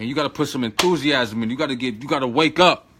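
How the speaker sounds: ordinary talk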